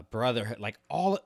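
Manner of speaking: ordinary talk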